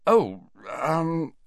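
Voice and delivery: stall, unsure what to answer; confused. Acted. Harry avoiding an honest answer to Ron, confusedly. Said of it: "he said confusedly".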